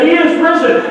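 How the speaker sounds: high, ringing shouted proclamation; yelled again. Retold or imitated. Priest yelling over the communist speaker. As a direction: shout it out triumphantly. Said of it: "he yelled it again and again".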